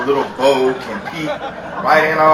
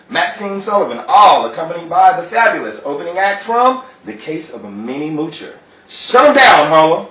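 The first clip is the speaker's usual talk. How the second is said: louder showman announcing; stage announcer; 4 dB louder than usual. Acted. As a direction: introduce the acts to the crowd. Announces the show.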